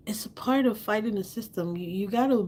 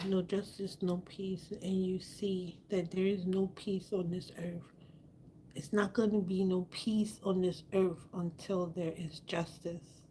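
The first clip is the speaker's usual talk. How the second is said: whispering; 9 dB quieter than usual. Real, not acted. Keeps whispering, mattress move.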